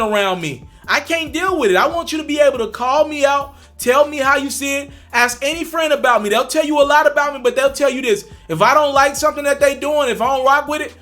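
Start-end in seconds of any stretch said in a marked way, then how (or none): none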